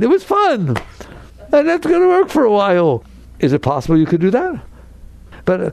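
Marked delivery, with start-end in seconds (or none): 0.0-3.0 high, chirpy voice of the same man; cheerful fired person. Acted. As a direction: act the fired man who found it fun. Same figure, cheerful about firing.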